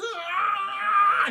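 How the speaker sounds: long, high, loud mock yell; hammed-up charging scream; battle scream. Han charging stormtroopers, mock battle yell. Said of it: "and he starts".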